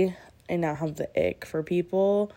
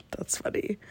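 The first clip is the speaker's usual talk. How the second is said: whispered aside; 9 dB quieter than usual. Real, not acted. Quiet aside about kitty.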